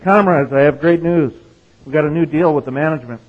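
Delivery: high, loud rallying cry to the comrades; excited announcement. Retold or imitated. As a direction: announce it like great news to a crowd. Shop steward announces to workers.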